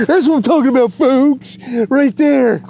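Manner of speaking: high and loud, buzzing with joy; thrilled fisherman; excited boast. Real, not acted. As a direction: crow it to the viewers. Showing off the catch.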